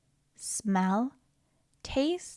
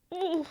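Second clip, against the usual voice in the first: disgust; 6 semitones higher, 6 dB quieter than usual. Acted. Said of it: "It stinks".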